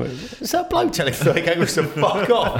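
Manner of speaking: high, laughing mock astonishment; laughing disbelief. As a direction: gasp it out as an amazed passer-by, laughing. Imagined onlooker's amazed remark.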